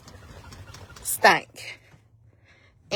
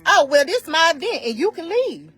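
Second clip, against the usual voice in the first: mock dismissive; 7 semitones higher than usual. Mocking dismissive host.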